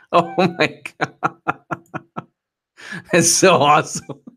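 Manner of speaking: slow, laughing disbelief. Real, not acted. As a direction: laugh through the words. Laughing at doxing attempt.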